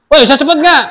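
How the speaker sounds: loud, high, harsh demand; rude impatient shout. Shown as a demonstration. Teacher modelling the rude way to rush a friend, harsh loud shout. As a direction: snap it like an annoyed bully. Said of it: "Jangan pernah berkata".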